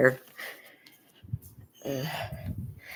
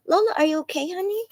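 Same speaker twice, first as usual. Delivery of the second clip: cooing to the dog; 13 semitones higher, faster than usual. Real, not acted. Owner cooing to her frightened dog Lola. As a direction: coax a scared dog gently.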